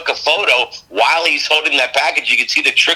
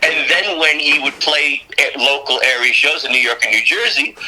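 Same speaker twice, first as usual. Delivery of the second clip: ordinary delivery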